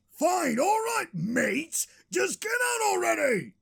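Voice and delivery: high, hammed-up angry shout; exasperated shouting. Host throws out his nagging producer, exasperated and shouting. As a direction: order him out at a high pitch.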